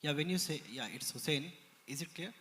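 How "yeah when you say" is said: speaking louder. Real, not acted. Attendee repeats question after being asked to speak up, louder. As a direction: speak up so the presenter can hear. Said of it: "could you please speak louder".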